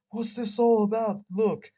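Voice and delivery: high, loud bewildered protest; bewildered outburst. Acted. Man stopped by a cop, protesting loud and bewildered. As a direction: blurt it out, high and loud, feigning confusion. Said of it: "full of shit, bewildered".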